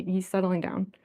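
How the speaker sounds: ordinary delivery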